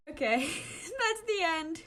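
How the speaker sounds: slow, giggly; laughing. Real, not acted. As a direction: say it through laughter. Reader giggling at the end.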